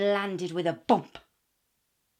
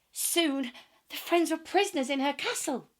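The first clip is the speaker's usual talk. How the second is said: plain reading voice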